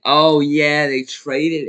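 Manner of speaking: loud, high burst of remembering; sudden realization. Real, not acted. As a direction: blurt it out as it comes back to you.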